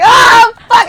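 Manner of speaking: loud, high cry of dread; mock scream at an unwelcome thought; acted scream. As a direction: yell it out in exasperation. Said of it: "the screams be like".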